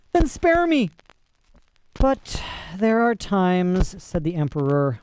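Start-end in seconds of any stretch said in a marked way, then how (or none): 0.1-0.9 very high-pitched cry for mercy; desperate pleading. A narrator's reading. Condemned flyer begs for his life. 2.0-4.0 slow, drawn-out regret; more deeply sad. A narrator's reading. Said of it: "more sadly still".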